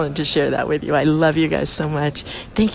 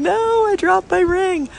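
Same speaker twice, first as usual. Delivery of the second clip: much higher, slow wail of dismay; distressed exclaiming; 13 semitones higher, 3 dB louder than usual. Retold or imitated. Girl in the toilet stall wailing to her friend, distressed. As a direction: cry it out as if the ring is gone for good. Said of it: "she's in there exclaiming".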